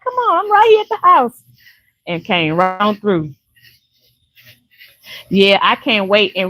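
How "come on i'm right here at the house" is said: high, excited hostess call; eager invitation. Retold or imitated. Imitating the neighbor calling from her house, high. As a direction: urge her in from the porch. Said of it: "She was like".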